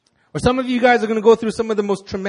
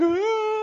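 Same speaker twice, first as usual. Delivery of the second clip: mock crying wail. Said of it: "she just started crying, like".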